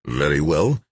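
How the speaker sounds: words forced through gritted teeth; tight-jawed, teeth clenched. A narrator's reading. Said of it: "he said through gritted teeth".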